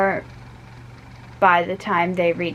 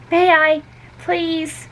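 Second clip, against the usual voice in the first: Emmy begging; 9 semitones higher, slower than usual. A narrator's reading. Emmy begs her mother.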